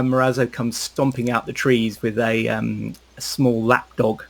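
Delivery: normal talking voice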